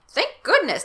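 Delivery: high, relieved burst; relieved exclamation. Acted. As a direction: relief as she grabs the axe.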